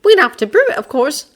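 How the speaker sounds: put-on high girl voice, reasoning; Hermione voice. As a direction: lay out the logic.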